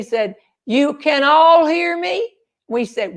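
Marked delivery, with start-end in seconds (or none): 0.7-2.4 high retold holler, rising question; calling out. Husband checking that the canoe group hears him, calling out. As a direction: call down to check they can hear.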